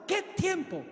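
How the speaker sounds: high-pitched, scornful snap back; acted outraged refusal; mock indignant retort. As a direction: throw the plea back at the debtor. Creditor rejects the plea angrily.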